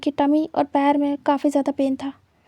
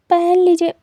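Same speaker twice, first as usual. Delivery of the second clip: embarrassed outburst; 4 semitones higher, 7 dB louder than usual. Acted. Wing embarrassed, asks to dress.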